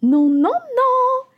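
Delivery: high, loud, slow little bread voice; tiny runaway bun squealing no; little simit crying no. A narrator's reading. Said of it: "Et le simite dit".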